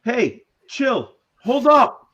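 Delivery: high, loud yell. Pretend. Player yelling at a fleeing kid, in-game yell. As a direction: yell to halt someone running off. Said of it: "what do you yell at him exactly?".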